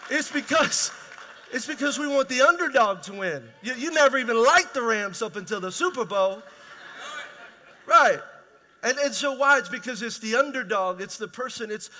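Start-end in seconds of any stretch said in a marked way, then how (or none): 0.1-11.0 full-force preaching. Acted. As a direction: drive the point home to the congregation.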